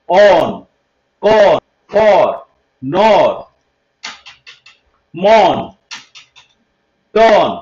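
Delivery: on, con, for with a rounded open 'aw'; isolated model words, long 'aw' in nor, mon; open 'aw' sound demo. Shown as a demonstration. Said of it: "ও দিয়েই অ উচ্চারণ আসে".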